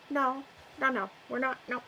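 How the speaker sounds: ordinary talk, nothing marked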